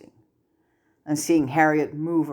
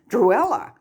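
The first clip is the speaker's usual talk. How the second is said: alarmed call; 9 semitones higher, 6 dB louder than usual. Acted. Draco calls to fallen cousin.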